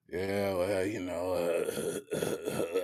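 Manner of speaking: low, soft, slow and poorly; mock an ill man struggling to answer; weary sickbed mumble, put on; imitating sick man. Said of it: "You don't feel well".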